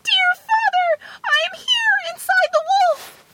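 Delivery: very high, tiny squeal of a voice; crying out. A narrator's reading. Tom Thumb calling to his father from inside the wolf, very high. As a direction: call for help in a piping little voice. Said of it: "heard his father's voice and cried".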